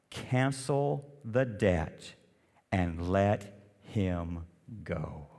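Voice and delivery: merciful master. Acted.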